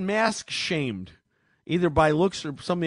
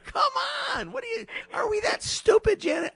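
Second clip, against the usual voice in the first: exasperated outburst. Real, not acted. Exasperated rant at caller.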